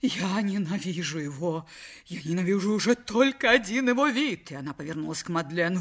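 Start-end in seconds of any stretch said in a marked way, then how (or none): 0.0-4.5 истеричный вопль. A narrator's reading. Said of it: "Миссис Уэйт издала вопль".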